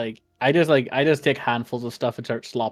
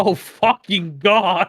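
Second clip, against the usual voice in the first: slow mock outcry; 8 semitones higher, 8 dB louder, much slower than usual. Mock outburst at the farmer.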